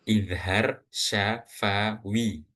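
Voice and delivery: "idhar syafawi" very slow, each syllable separated; measured teaching pronunciation of "idhar syafawi". Shown as a demonstration. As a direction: spell out "syafawi" as sya, fa, wi. Teacher spells out the new term.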